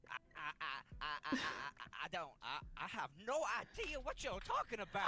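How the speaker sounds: panicked high protest; flustered denial. Acted. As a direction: caught off guard, sputtering innocence. Accused player stammers in denial.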